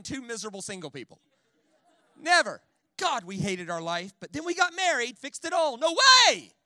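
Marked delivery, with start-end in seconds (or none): 2.2-2.6 a sudden loud, high "Never."; emphatic never. Acted. Preacher hammering home his point to the church, loud. Preacher punches one word. 3.0-5.8 mock couple testimony. 5.8-6.5 loud, high "No way!"; emphatic no way. Acted. Preacher scoffing at a foolish idea, loud and high.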